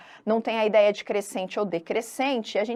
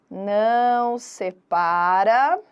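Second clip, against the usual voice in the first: drawn-out words with a rising end; slow dictation; 2 semitones lower, much slower than usual. Shown as a demonstration. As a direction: stretch it out as if writing along. Dictates while writing on the board. (ditado arrastado, final subindo)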